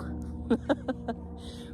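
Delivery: breathy-edged cackle after the punchline; raucous laugh. A narrator's reading. Peacock names raven as its wish, cackling. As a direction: cackle at your own answer. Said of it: "The peacock laughs his raucous laugh".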